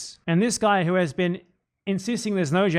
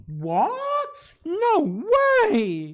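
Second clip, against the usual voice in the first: much higher hammed-up wail of disbelief; mock outrage; 12 semitones higher, much slower than usual. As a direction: pretend to be crushed by the news. Sarcastic mock disbelief bit.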